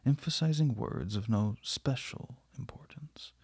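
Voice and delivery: usual speaking voice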